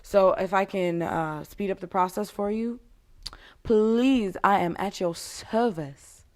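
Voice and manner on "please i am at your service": ordinary talk, nothing marked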